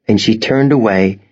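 plain reading voice

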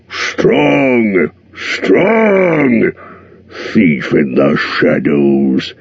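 slow, booming dragon boast; gloating dragon. A narrator's reading. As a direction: relish every threat like a proud old dragon. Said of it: "he gloated".

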